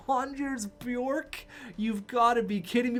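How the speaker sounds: can't believe the goal went in; stunned disbelief. Real, not acted. Gamer groaning at his screen in stunned disbelief.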